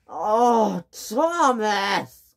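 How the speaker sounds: high crying scream at Thomas. Acted. James screaming at Thomas after the crash. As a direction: wail his name in dismay. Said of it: "screamed James".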